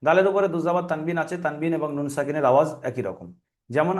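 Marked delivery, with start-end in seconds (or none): none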